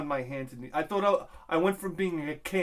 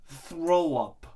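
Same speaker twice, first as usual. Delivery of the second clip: usual speaking voice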